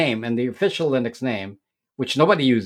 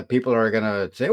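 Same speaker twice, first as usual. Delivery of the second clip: normal talking voice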